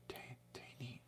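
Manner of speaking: barely voiced, testing the name; whispered to himself. Real, not acted. Practising the viewer's name alone, whispered. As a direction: whisper "Taine" under his breath.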